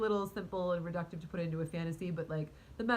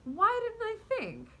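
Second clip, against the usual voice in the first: excited realization; 6 dB louder than usual. Retold or imitated. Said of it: "the manager immediately goes".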